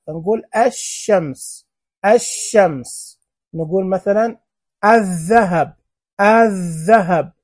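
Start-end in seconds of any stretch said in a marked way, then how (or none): 0.1-3.2 "الشمس" slowly as ash-shams, doubled sh; straight from alif to sheen. Shown as a demonstration. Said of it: "إحنا ننطق ألف شين على طول". 4.8-7.3 "الذهب" as adh-dhahab, doubled first consonant, no L; silent lam. Shown as a demonstration. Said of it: "وين اللام؟".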